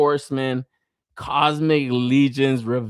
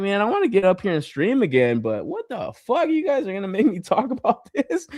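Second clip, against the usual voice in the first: high, incredulous griping with a laugh; exasperated complaint; 8 semitones higher than usual. Retold or imitated. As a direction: protest in amused disbelief. Mock complaint about streaming this.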